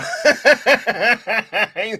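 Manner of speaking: real rapid-fire laugh; quick, helpless cackle; burst of laughter. Real, not acted. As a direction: crack up at your own joke. Laughs at own cat joke.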